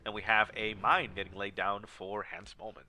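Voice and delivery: ordinary talk, nothing marked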